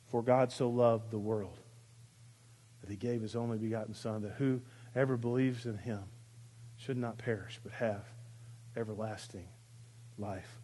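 soft, low, reverent reading of the verse; hushed scripture recitation. Acted. Pastor reciting John 3:16 to the congregation, hushed and reverent. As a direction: let the verse fall quietly on the room.

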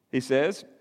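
normal talking voice

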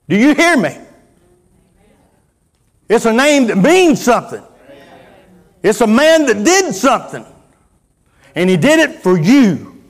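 loud, high preacher's delivery; full-force preaching. Real, not acted. Preacher telling the church why Christ suffered, full-force preaching. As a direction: drive it home to the congregation, loud and high.

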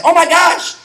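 high mock surprise; imitating himself caught off guard; startled. Pastor reliving his reaction to Siri, high and startled. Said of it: "but I was like".

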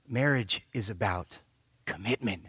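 quiet, earnest sermon point; hushed emphasis. Real, not acted. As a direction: drop to a near hush for weight. Sermon point brought down low.

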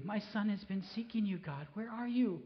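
high plea sinking to a hush; anguished question trailing off quietly; pleading, fading. Retold or imitated. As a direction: let the plea fall away to nothing. Father's plea, dropping to quiet.

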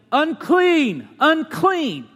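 high, loud warning cry; leper's yell. Retold or imitated. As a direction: shout the warning into the crowd. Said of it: "immediately he's yelling".

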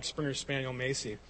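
ordinary talk

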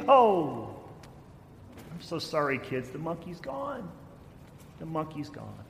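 put-on mother softly telling the kids the monkey left; mock sorry. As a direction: let the kids down gently, hushed. Mother ends the game.

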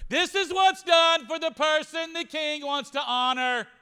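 high, loud, slow herald's cry. Shown as a demonstration. Haman crying out through town ahead of Mordecai, loud and slow. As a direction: proclaim it to the whole town. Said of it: "like, louder".

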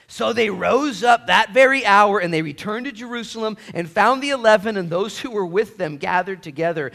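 loud, high scripture reading; booming sermon reading; preaching at full force. Acted. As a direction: proclaim the verses.

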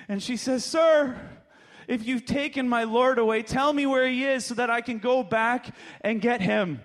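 high, emphatic storyteller voice; dramatic storytelling. Acted.

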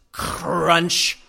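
loud, drawn-out crunching word; imitated crunch. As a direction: make the word sound like something being crushed. Imitates car crushing baby seagull.

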